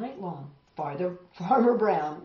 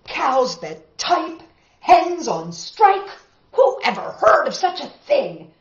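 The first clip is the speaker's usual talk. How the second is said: furious farmer; 7 semitones higher, 10 dB louder than usual. Acted. Farmer fuming over striking cows and hens, loud rant. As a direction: rant in disbelief and anger. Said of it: "Farmer Brown was furious".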